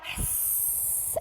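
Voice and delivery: long breathy cat hiss with no voice. Shown as a demonstration. Teacher shows kids the cat pose, long hiss. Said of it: "Hiss like a cat".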